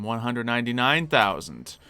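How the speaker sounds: ordinary talk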